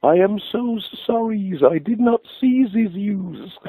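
high, sing-song Swedish trucker apologising; Swedish accent. Retold or imitated. Lorry driver apologising after the crash, in a Swedish accent. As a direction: put on a lilting Swedish accent. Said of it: "in a lovely Swedish accent".

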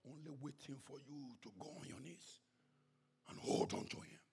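very soft, low near-whisper; hushed appeal. Real, not acted. Preacher urging people to kneel in prayer, hushed. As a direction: drop right down to a hush.

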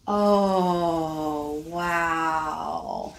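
normal talking voice

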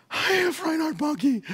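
high-pitched mock worry; mock anxious thought. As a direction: fret in a high voice, as a retold panicky thought. Acted anxious thought at the crossing.

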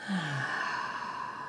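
slow audible exhale sigh; long release sigh. Shown as a demonstration. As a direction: let out a long relaxing sigh. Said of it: "releasing with the sigh".

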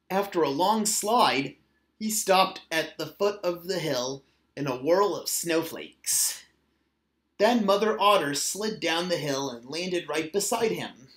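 ordinary delivery

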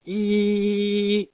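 long, high-pitched squeal. Acted. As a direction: shriek like a toddler. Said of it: "make silly sounds like".

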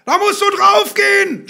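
high, loud touchline yell; full-force high shout. A narrator's reading. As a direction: yell it like an enraged football dad. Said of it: "schrie er".

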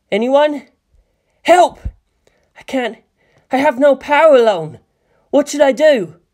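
panicked cry for help. A narrator's reading. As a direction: cry out, completely overwhelmed.

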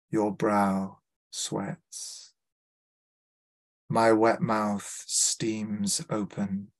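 slow, deliberate poem reading; poetry reading. Acted. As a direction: savour each image, pausing.

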